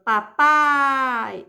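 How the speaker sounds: "Papai" with a very long, strong "pai"; stress on final syllable. Shown as a demonstration. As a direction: stretch out the end of "Papai". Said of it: "é a última". ("Papai" alongando bastante o "pai")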